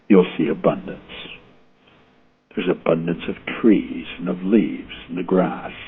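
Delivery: ordinary delivery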